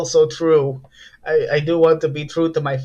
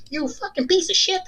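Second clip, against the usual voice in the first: much higher, voiced insult; angel voice insult. The angel curses the helicopter out, much higher. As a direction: spit it out in the high voice.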